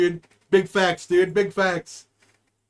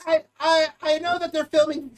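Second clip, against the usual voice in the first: dispatcher voice pitched higher; 4 semitones higher than usual. Retold or imitated. Joking dispatcher radios units, voice pitched higher. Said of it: "like how high pitch you got".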